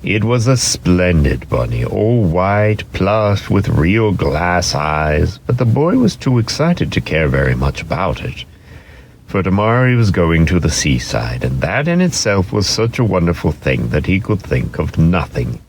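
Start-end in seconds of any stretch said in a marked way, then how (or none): none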